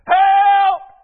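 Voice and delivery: full-throated high shout; drawn-out, high-pitched holler; yelled cry for help. Retold or imitated. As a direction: yell it out for a nurse, urgent and very loud. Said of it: "I yelled that most famous".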